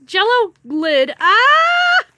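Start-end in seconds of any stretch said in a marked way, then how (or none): 1.2-2.0 sudden loud high yelp; a sharp startled cry as the pet lunges. Real, not acted. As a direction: jump and yelp at the surprise.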